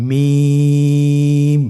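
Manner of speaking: মিম stretched very long and held with lips closed; drawn-out letter name. Shown as a demonstration. Teacher modelling recitation for students, long drawn-out মিম. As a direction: hold মিম for three counts, thin and loud. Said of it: "তিন আলিফ টেনে উচ্চারণ করতে হবে".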